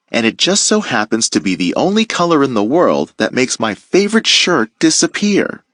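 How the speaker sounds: ordinary delivery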